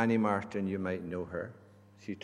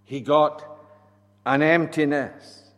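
preaching; 2 semitones higher, 12 dB louder than usual. Acted. Preaching at full force.

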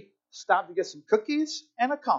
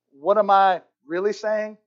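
ordinary talk, nothing marked

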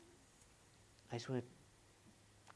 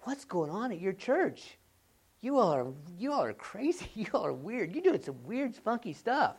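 mocking friend; 5 semitones higher, 5 dB louder than usual. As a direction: tease them for being crazy.

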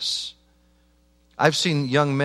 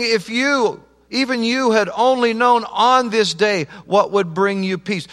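impassioned lament, loud; 10 semitones higher, 9 dB louder than usual. Acted. Jesus's lament quoted at full force.

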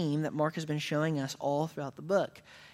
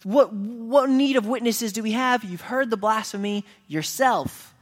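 loud, higher outraged shout; outraged priest yelling; 6 semitones higher, 6 dB louder than usual. Retold or imitated. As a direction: roar the verdict at the court. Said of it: "he yells".

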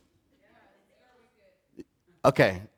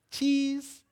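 mock photo smile; 9 semitones higher, 8 dB quieter than usual.

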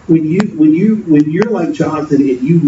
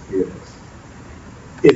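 drawn-out hushed whisper, much softer; slow whisper; 4 semitones lower, 7 dB quieter, much slower than usual. Shown as a demonstration. Pastor whispering to the congregation before a bold idea. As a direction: lean in and breathe it very slowly. Announces the point, then whispers it.

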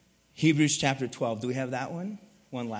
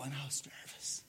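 whisper; 14 dB quieter than usual. Real, not acted. Nervous before preaching.

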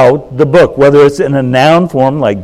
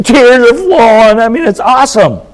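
excited; 10 semitones higher than usual. Real, not acted. Preacher gushing over homecoming reunions.

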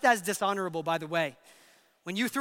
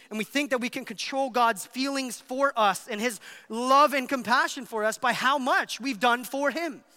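usual speaking voice

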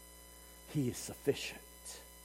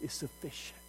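whispered; 4 semitones lower, 16 dB quieter than usual. Retold or imitated. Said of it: "you just hear him whisper".

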